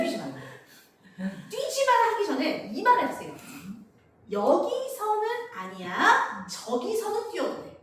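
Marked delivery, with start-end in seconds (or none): 0.0-2.1 nagging parent. Retold or imitated. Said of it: "뛰지 마란 말 좀 하지 마세요". 4.3-7.8 calm instruction. Shown as a demonstration. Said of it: "이렇게 얘기하셔야 돼요".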